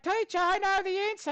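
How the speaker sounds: high-pitched mock schoolkid with Australian accent; eager pupil, Aussie accent. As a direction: pipe up like a keen Aussie pupil. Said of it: "you raise your hand, and you're like".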